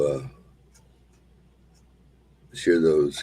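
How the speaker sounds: usual speaking voice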